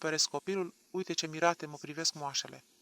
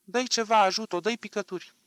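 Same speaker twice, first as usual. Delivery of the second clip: loud, higher demand with menace; threatening shout; 5 semitones higher, 9 dB louder than usual. A narrator's reading. Mother threatening the doctor, shouting for drops. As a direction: yell the order at him threateningly. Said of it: "îmi strigă cu glas amenințător".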